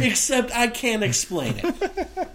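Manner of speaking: high, slow whiny blogger. Retold or imitated. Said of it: "all of them went on to say".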